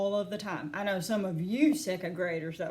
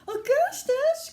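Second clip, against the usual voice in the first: very high, loud, drawn-out call; long high-pitched parental cry; 5 dB louder, slower than usual. A narrator's reading. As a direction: holler the name in alarm. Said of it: "cried Mister and Missus Gloop".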